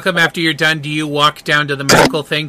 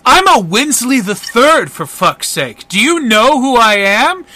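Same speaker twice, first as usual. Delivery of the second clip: higher-pitched, blustering outrage; indignant entitled man; 4 semitones higher than usual. Acted. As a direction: protest like a pompous man threatening his lawyer. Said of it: "the guy goes".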